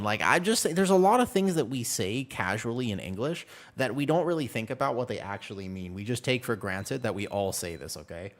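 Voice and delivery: ordinary talk, nothing marked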